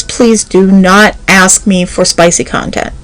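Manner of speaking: ordinary delivery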